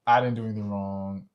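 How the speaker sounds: ordinary delivery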